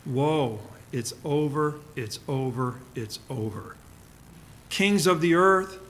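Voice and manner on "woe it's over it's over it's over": fading to a hushed, grieving near-whisper; a quiet mourner's sigh of loss; heartbroken lament. Acted. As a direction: let each it's over sink softer, mourning. They're heartbroken.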